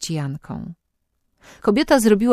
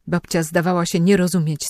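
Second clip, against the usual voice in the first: usual speaking voice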